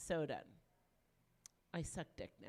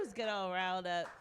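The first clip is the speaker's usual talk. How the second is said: ordinary talk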